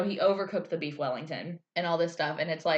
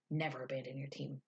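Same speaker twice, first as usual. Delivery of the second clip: quiet stern Gordon; 4 semitones lower, 12 dB quieter than usual. Retold or imitated. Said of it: "Gordon was like".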